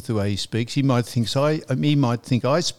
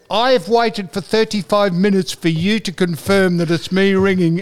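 retold raised voice; 3 semitones higher, 4 dB louder than usual. Fed-up caller complains to phone clerk, raised voice retold. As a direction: re-enact an exasperated complaint, hammering it out. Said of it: "the way you raised your voice".

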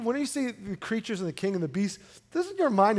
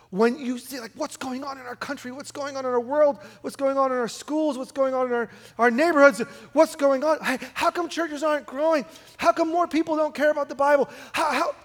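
higher-pitched, worked-up string of anxious questions; mock panic; 6 semitones higher, 3 dB louder than usual. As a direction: imitate a worrier spiralling, questions tumbling out. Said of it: "Hey, calm down".

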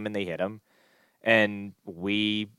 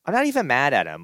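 exasperated protest; 7 semitones higher than usual. Retold or imitated. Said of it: "I'm like".

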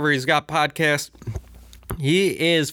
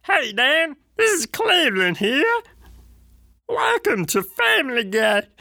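much higher cartoon-dad voice, friendly greeting; Cleveland voice; 12 semitones higher than usual. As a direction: call out a high, sunny Family Guy hello. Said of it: "Does that still sound like Cleveland?".

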